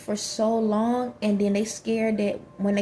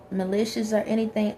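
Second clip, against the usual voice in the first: usual speaking voice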